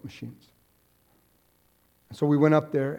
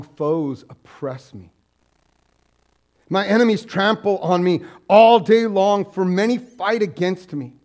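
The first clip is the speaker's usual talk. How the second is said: solemn psalm reading, rising louder at times; scripture reading; 3 semitones higher, 6 dB louder than usual. Acted. Pastor reading scripture to congregation, solemn. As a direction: read the psalm with weight.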